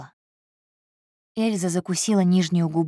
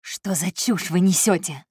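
usual speaking voice

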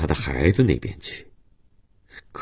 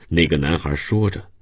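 ordinary delivery, nothing marked

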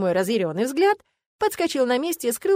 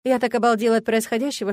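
ordinary delivery, nothing marked

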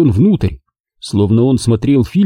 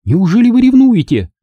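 high, louder mocking outburst; exclaiming; 12 semitones higher, 4 dB louder than usual. A narrator's reading. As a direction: throw the jab at him loudly. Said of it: "воскликнула она".

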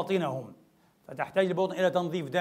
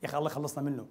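lower, softer mocking aside; the heart's hidden sneer; 6 semitones lower, 10 dB quieter, faster than usual. Preacher voicing a hypocrite's secret scorn, low and soft. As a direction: mutter it with contempt behind a smile. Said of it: "وقلبه لك".

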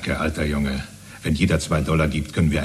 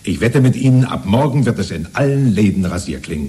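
eager boast; 5 semitones higher, 5 dB louder than usual. Acted. Zealous neighbour boasts.

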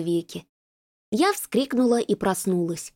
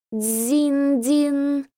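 high ringing bell sound; bell chime imitation; 5 semitones higher than usual. A narrator's reading. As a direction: ring it out like a temple bell. Temple bell ringing in scene.

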